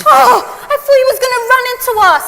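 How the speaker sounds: high, loud panicked warning; alarmed shout. Acted. Passenger warns Margaret of the crash, shouting in alarm. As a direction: yell a warning at the driver.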